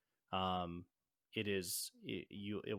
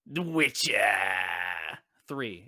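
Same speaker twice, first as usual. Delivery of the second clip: grand reveal; 9 dB louder, slower than usual. Acted. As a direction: proclaim it like a game show host. Reveals his fifth game.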